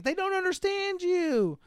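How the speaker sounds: high, whiny-pitched mock protest; acted objection, voice way up; protesting kids. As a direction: plead it in a put-on kid's voice.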